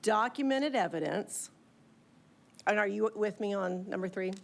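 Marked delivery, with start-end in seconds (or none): 0.0-1.5 slow, careful dictation; dictating. Real, not acted. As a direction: spell it out slowly for the note-taker. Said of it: "P-, please go slow".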